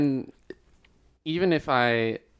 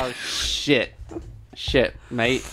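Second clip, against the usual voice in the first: mock Aussie "shit, mate"; lightly put-on Australian accent; much slower than usual. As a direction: play a jokey Aussie bloke. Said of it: "over here in Australia town".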